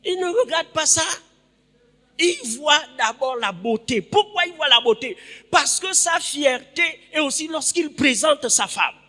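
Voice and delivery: high, loud preaching voice; fervent preaching. Real, not acted. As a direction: drive the point home to the congregation. Preaches at full force. (prédication fervente, voix haute)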